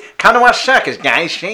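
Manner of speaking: high, loud shady showman; sketchy circus barker. Acted. Rizzo brother luring punters to the circus, sketchy barker voice. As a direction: holler it like a dodgy ringmaster. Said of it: "why they've got that accent".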